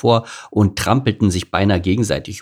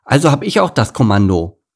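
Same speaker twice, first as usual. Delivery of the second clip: put-on loud, high bossy kid; raised childlike indignation; indignant cub; 7 semitones higher, 6 dB louder than usual. A narrator's reading. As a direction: insist on being in charge, affronted. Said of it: "empörte sich Lukas".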